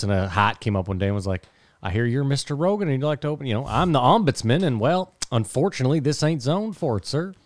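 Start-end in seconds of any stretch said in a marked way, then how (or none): 1.8-7.3 higher, put-on Texan accent, badly done; a clumsy Texan ombudsman accent, pitched up; terrible accent. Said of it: "that was a terrible accent".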